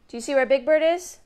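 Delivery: ordinary delivery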